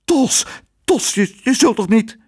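high, stammering and scared; frightened stutter. A narrator's reading. The hotel lady stammers in fright at the raging cook. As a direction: back away stammering in fear. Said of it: "achteruit deinsde en stotterde".

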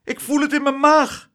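high, loud queasy wail; alarmed, high-pitched cry; cried out. A narrator's reading. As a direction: squeal it in a panic. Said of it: "riep juffrouw Klaterhoen".

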